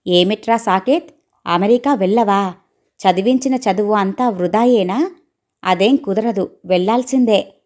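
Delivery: ordinary delivery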